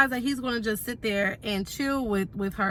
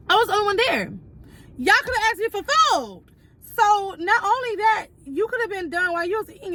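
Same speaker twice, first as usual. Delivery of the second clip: exasperated outrage; 10 semitones higher than usual. Real, not acted. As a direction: voice climbing in disbelief at being ignored. Building indignation at the stylist.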